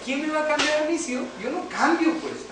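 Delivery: high-pitched retold objection; defiant protest. Man tells God nobody will change him, high defiant protest. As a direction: act out an indignant refusal, pitched well up.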